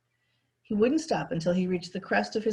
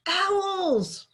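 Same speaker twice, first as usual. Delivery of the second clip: high, drawn-out triumphant cry; 13 semitones higher than usual. A narrator's reading. Roy shouting back triumphantly as he rides off. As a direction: proclaim it in victory. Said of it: "Roy proclaimed triumphantly".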